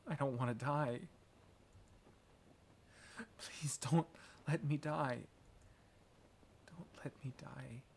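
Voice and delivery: hushed, half-whispered begging; whispery, faltering plea; frightened plea. Acted. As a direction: beg softly for your life. Dying character pleads for life.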